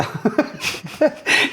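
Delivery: high breathy laugh; a laugh that jumps up. Real, not acted. Laughs recalling his reaction.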